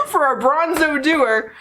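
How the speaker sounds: high, slow, laughing delivery; laughing through words. Real, not acted. Laughing at own word slip.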